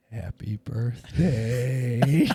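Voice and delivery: very slow, stretched-out greeting; drawn-out birthday greeting. Retold or imitated. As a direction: re-enact a groggy greeting, dragging out each word. Groggy first words to his wife.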